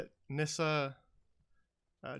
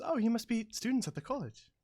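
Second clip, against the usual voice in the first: higher, louder eager greeting; excited gnome woman; 10 semitones higher, 5 dB louder than usual. Acted. Gnome visitor greets the students, higher and excited. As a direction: bounce in like a thrilled little visitor. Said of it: "excitedly, approaches and says".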